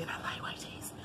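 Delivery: soft unvoiced whisper. Real, not acted. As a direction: breathe the words in a whisper. Whispered aside while listing teas.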